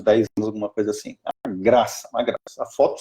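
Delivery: ordinary delivery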